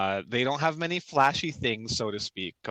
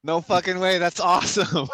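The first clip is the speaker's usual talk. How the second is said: excited; 7 semitones higher, 6 dB louder than usual. Real, not acted. Hears about a great ability.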